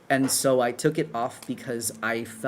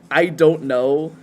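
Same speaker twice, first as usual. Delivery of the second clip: amused; 3 semitones higher, 9 dB louder, much slower than usual. Real, not acted. Laughing at a funny pronunciation.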